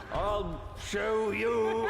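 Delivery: very slow, drawn out. Retold or imitated. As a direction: stretch each word out slowly. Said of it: "why did you slow down going".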